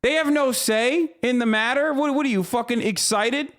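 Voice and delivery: what on earth are they doing; incredulous outburst. Real, not acted. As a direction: pitch jumps up in outraged disbelief. Outraged at Special Olympics ban.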